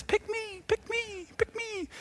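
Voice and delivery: very high, soft far-off cries; distant kids. Acted. Mom hears preschoolers at recess, high faint distant calls. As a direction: call it tiny and high, as from across a playground. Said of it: "in the distance".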